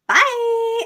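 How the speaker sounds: mock YouTuber sign-off; imitating a vlogger. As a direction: chirp the goodbye. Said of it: "she's like".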